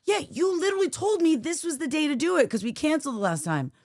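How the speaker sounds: high-pitched protest; retold indignation. Wife reminding husband of their plan, retold indignation. Retold quarrel, her own side.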